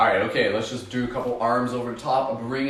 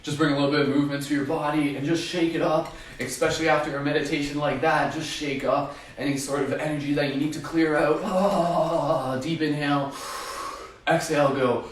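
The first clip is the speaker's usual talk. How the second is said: upbeat instructor; 5 semitones higher than usual. Acted. Leading movement, higher far voice.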